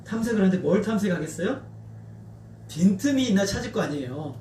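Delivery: normal talking voice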